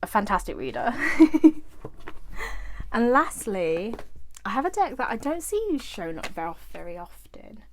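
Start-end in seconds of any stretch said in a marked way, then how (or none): 1.0-2.9 bright laugh. Real, not acted. Laughing at own joke.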